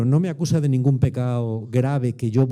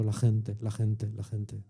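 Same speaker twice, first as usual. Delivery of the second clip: fading repetition; 4 semitones lower, 8 dB quieter, much slower than usual. Real, not acted.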